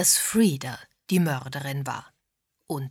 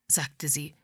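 usual speaking voice

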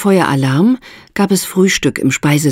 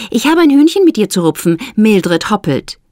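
Esther, lightly challenging; 7 semitones higher than usual. A narrator's reading. Esther confronts Mildred.

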